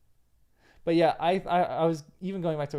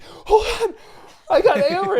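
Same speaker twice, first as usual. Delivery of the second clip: much higher, much louder excited guard; eager high voice; 13 dB louder than usual. Retold or imitated. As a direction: burst in proud and breathless with news. Eager Hama brings Éomer.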